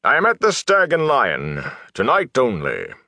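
high-pitched showman's proclamation, falling at the end; resounding announcement. A narrator's reading. Gleeman advertising to onlookers, resounding announcement. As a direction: proclaim it like a touring performer. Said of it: "announce in a resounding voice".

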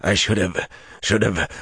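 breathy, broken repetition; panting. A narrator's reading. Lews Therin rues not killing him, panting. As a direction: pant the words as they fail you. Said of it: "Lews Therin panted".

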